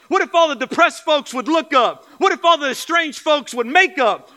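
high, loud rallying preacher cadence; shouted revival-style exhortation; full-force preaching. Real, not acted. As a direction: fire up the congregation with pounding repeated what-ifs.

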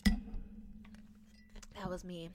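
slow, breathy whisper right into the mic; ASMR whisper. Shown as a demonstration. Doing ASMR for the viewers in a slow whisper. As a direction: whisper it slowly like an ASMR video. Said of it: "I'm doing some A S M R".

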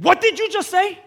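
high, loud in-her-face demand; angry retold confrontation; confrontational challenge. Gets in the nurse's face.

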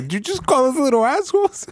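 high, incredulous question; retold stunned disbelief. Stunned students to the teacher who swore, high disbelief. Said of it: "we're all stunned".

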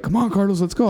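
high teasing call; lightly voiced teasing gym buddy; mock taunt. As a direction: egg him on in a high voice.

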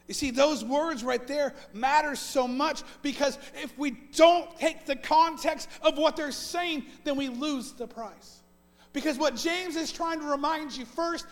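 building sermon emphasis; preaching at full force. Acted. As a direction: lean in and drive the point home. Preaching the point of the text.

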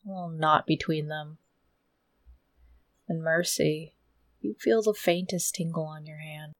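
slow, low, hushed scene setting; low storyteller's voice, unhurried; game master narration. A narrator's reading. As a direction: draw the players in slowly, low and soft.

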